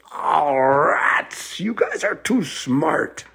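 drawn-out wailing troll voice; groan, then rise high in slow exasperation; hammed-up troll in defeat; exasperated troll. Troll loses second riddle.

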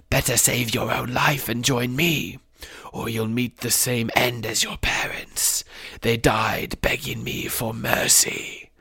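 slow, soft sinister whisper; breathy threat, savouring every word; menacing villain. A narrator's reading. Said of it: "snarled the face".

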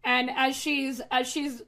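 normal talking voice

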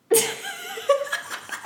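very high-pitched laugh; squealing giggle fit. Real, not acted. Amused by co-host's aphrodisiac remark.

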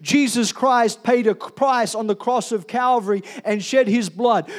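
normal talking voice

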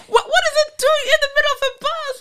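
high, fast and incredulous; baffled. Retold or imitated. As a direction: squeal it in disbelief. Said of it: "I'm like".

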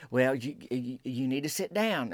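grave and hushed. Retold or imitated. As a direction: hold back and say it gravely. Acted grave news bearer.

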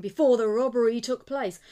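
loud desperate plea; cry out. A narrator's reading. Slugger keeps crying after the thief, loud. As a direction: keep shouting after him. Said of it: "cried the man who was Slugger".

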